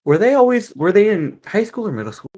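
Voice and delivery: loud, high eager question; excited question. Real, not acted. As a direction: blurt it out eagerly.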